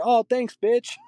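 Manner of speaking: very high, gushing thanks; mock slang-talking kid. Kid thanking grandma for a sweater, high mock gushing. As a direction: squeal it like an online kid. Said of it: "It's that kid just to grandma".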